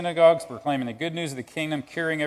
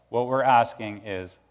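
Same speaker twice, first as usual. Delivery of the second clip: ordinary talk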